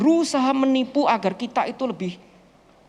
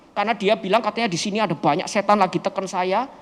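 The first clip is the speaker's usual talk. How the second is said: ordinary talk, nothing marked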